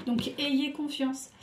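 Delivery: ordinary talk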